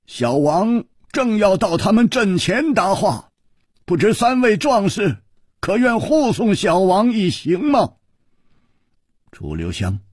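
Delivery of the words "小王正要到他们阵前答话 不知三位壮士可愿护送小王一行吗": high, projected royal request; genial king. A narrator's reading. King asks three warriors to escort him, high and courtly.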